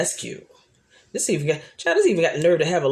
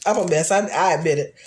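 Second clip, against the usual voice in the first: ordinary talk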